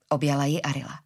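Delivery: plain reading voice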